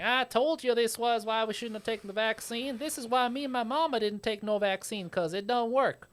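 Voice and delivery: high, mocking yokel voice. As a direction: sneer it like a smug anti-vaxxer.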